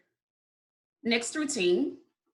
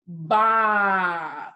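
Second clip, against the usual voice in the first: BOX stretched long and louder; said slowly; 3 semitones lower, 4 dB louder than usual. Shown as a demonstration. Teacher demonstrating the spelling routine, BOX said slowly. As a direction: hold the vowel of BOX, then release the ks. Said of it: "say it slowly".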